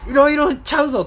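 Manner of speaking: 高く大声で畳みかける; 混乱して一気に問い詰める; まくしたてるツッコミ. Retold or imitated. 父への興奮したツッコミ. (父に興奮して次々ツッコむ)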